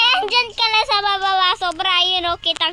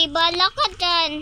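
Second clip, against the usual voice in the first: ordinary talk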